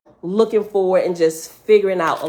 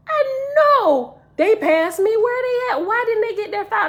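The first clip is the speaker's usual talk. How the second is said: distressed disbelief; 14 semitones higher than usual. Retold or imitated. Dreamer baffled that others never got their files, distressed disbelief. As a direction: cry out in baffled alarm, voice way up. Retold shock at friends missing in dream.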